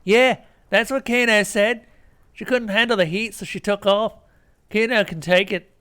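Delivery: high rough street voice, loud; Kano's voice. Acted. Player reading the game's Kano loudly. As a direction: play him cocky and loud.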